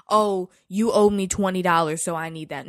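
loud, mocking petty voice; mocking petty demand. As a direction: mimic someone nagging over a debt. Mocking petty squabbling quote.

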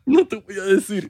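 negativa aguda de personaje cómico; niégate con voz impostada y más aguda; voz rara fingida. Pretend. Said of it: "por qué estamos hablando así". (secretismo de parodia)

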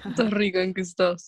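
lightly voiced low fuckboy; a hint of fuckboy chat-up. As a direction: drop the pitch for a mock fuckboy come-on. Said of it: "decirlo como de fuckboy".

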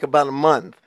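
ordinary talk

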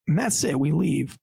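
usual speaking voice

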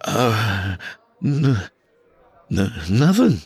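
trembling. Acted. Tony answering the killer, mild trembling stammer. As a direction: get the word out through fear. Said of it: "Tony's voice trembled".

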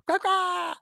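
high squawking goose call; goose call imitation. Imitates geese calling out from rooftops with a high squawk. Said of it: "I did the same noise three times".

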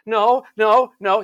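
high, loud fearful refusal; child's scared no. Retold or imitated. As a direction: back away crying no. Child backing away from doctor.

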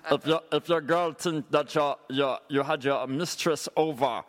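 mock Jamaican dancehall sales pitch; sing-song reggae toaster selling a kitchen gadget. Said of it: "doing a [name]".

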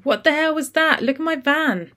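high, loud furious yell; angry shout. Acted. Van driver shouting at Khaled over the dented van. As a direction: bellow it at the man behind the windscreen.

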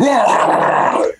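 sudden high, breathy yell imitating the blitz; mock defensive roar. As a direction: bark it like a charging linebacker.

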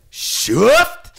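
high, loud catchphrase with a rise; a high, loud catchphrase call; loud shift. Retold or imitated. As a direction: belt out shift, ending up high. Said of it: "I went".

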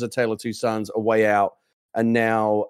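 ordinary delivery

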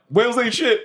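high, giggling through the slogan; laughing defiance. Retold or imitated. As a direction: cackle the slogan to yourself. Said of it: "in my head, I think back and I'm like".

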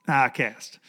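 I cast said through a laugh. Shown as a demonstration. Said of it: "That's what I say".

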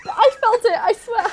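high-pitched, laughing plea; pleading protest. Acted. Actress answering the director after failed take, pleading protest.

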